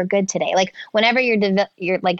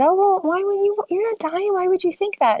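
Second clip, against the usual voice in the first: much higher, tender reassuring voice; soothing mom; 13 semitones higher than usual. Retold or imitated. Mother reassuring her daughter she is not dying, high and tender.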